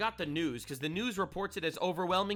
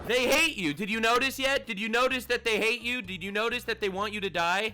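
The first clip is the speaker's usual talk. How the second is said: louder and higher, pressing each question; urgent warning; 7 semitones higher, 9 dB louder than usual. Real, not acted. Vlogger warns his audience, loud and urgent. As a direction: hammer the point home to the viewer. Warning viewers, rising intensity.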